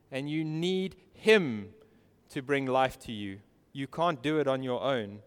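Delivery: ordinary delivery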